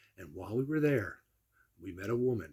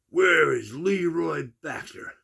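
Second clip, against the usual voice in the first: mock angry yell; 4 semitones higher, 6 dB louder, slower than usual. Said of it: "And I was screaming".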